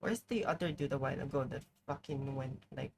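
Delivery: usual speaking voice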